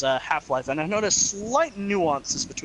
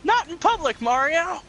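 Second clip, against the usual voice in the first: raised, prim little voice; high-pitched scandalized scold; 12 semitones higher, 3 dB louder than usual. Acted. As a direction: squeal it like a shocked cartoon flower. Flower scolding Mario.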